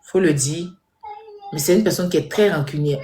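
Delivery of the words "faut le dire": usual speaking voice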